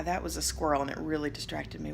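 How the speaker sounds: normal talking voice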